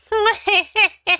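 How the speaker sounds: high, hammy villain cackle; evil cackle. Acted. Villain cackling at his trapped foes. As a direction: cackle like a cartoon dark lord.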